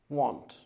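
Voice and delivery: "Want." said with the vowel of want, quietly; pronounced want. Shown as a demonstration. Teacher showing students how won't sounds, said like want. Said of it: "it's pronounced like this".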